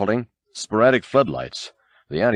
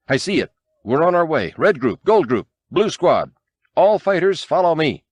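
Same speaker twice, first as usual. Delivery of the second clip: rallying call; 7 semitones higher than usual. A narrator's reading. Lando rallying squadrons into attack.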